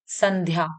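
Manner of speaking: "संध्या" as san-dhyaa; half-n sound. Shown as a demonstration. Teacher showing pupils the half न, n in "संध्या". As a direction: clear n in "संध्या". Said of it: "आधा ना का स्वर आ रहा है".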